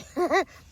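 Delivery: a sudden shrieking laugh; loud laugh. Real, not acted. Vlogger laughing at mushrooms she came too late for, loud burst.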